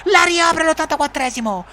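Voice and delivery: high goal shout; breathless italian goal commentary. Acted. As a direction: shout the brace.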